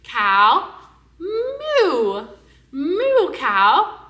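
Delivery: big, high moo between the cows; exaggerated animal word. Shown as a demonstration. Teaching a toddler the cow sound, exaggerated. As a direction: model cow and moo loudly. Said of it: "I am making that".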